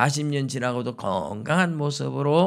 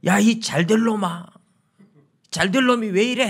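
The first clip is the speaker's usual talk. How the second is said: higher, louder mock scolding yell; mock scolding shout; 7 semitones higher, 5 dB louder than usual. Mother scolding her misbehaving child, a loud mock scolding shout. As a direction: scold a child loudly but with blessing words. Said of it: "야단을 치더라고요".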